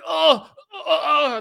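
mock ecstatic fans. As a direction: swoon like a fan. Grown men cheering at rally.

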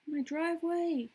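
mock neighbor freaking out. Neighbor calling the cops on a stranger, high mock panic. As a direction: shriek it like a scandalized homeowner. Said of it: "They're like".

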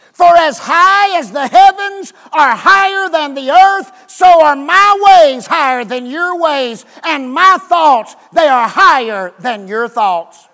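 preaching. Acted. Preacher quoting God through Isaiah.

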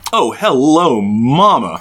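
flirty hello mama voice. Retold or imitated. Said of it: "It's just very".

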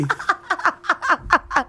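high giggling laugh. Real, not acted. Caller laughing at his own admission, high giggle. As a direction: burst out laughing at yourself.

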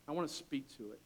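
ordinary delivery

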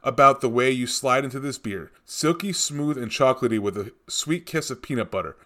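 normal talking voice

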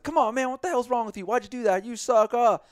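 high, loud hammed-up scolding; mock outraged players yelling at a teammate; mock angry yelling. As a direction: berate him like furious teammates. Teammates yelling at Will Ferrell.

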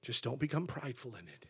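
ordinary talk, nothing marked

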